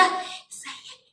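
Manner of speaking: everyday requests in a faint whisper. Shown as a demonstration. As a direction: whisper household orders with almost no sound. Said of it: "اتكلمي بالصوت ده". (همس من غير صوت)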